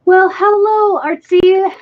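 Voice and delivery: high, bright hello; sing-song greeting. Real, not acted. Greeting someone who walks in.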